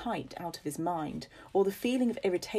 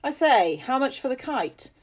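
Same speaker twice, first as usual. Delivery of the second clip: much louder, higher jovial call; hearty call; 8 semitones higher, 7 dB louder than usual. Acted. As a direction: call out across the group. Father calls to travellers.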